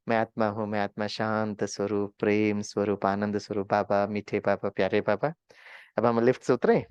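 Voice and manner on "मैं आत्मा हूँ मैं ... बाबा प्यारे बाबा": lower, calm meditation chant; meditative affirmation. Acted. Sitting before the trip, affirming in calm meditation.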